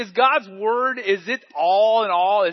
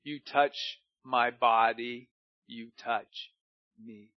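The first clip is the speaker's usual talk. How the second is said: lower, slow, solemn divine voice; voice of the Lord; 7 semitones lower, slower than usual. Acted. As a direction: speak as God calling out, slow and deep. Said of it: "the Lord said to him".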